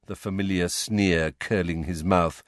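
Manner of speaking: usual speaking voice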